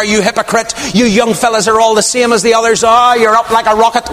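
high, fast hammed-up scolding; mock tirade. What Abigail could have screamed at David, high mock tirade. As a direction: rant at him like a shrew. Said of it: "scream and shout at him".

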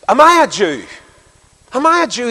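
high, loud indignant question; acted indignant outburst; angry retort. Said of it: "he answered angrily".

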